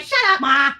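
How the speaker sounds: a sudden loud shout, playful; hammed-up baby yelling back; mock baby yell. Baby shouting at mom, mock loud. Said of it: "She's like".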